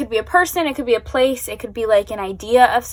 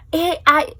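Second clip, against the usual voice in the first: emotional; 7 semitones higher than usual. Real, not acted. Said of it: "I can't form words".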